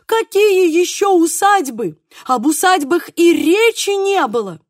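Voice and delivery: high, loud indignant protest; deeply offended cry. A narrator's reading. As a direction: protest loudly, wounded to the core. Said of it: "крикнул он со смертельной обидой". (возмущённо, с обидой)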